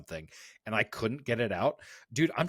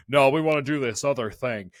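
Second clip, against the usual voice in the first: mock stubborn reply; 3 semitones higher, 8 dB louder than usual. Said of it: "they're like".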